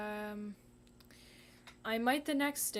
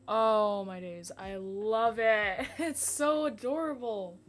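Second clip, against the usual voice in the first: loud, drawn-out gushing over something cute; thrilled, stretched-out cooing at full volume; gushing delight; 11 dB louder, slower than usual. Real, not acted. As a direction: squeal with adoration, stretching the words. Gushing over her drawing.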